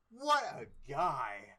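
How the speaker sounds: loud, slow, dreamy exclaim; swooning exclaim. Acted. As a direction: gush over him loudly. Swooning over the chicken.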